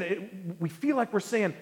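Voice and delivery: usual speaking voice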